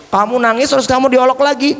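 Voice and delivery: ordinary delivery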